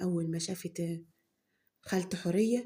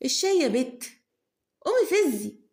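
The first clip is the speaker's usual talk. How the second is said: bossy order; 8 semitones higher, 6 dB louder than usual. Acted. Mother orders daughter to make tea.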